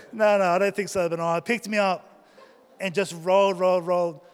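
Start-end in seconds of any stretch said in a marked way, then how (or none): none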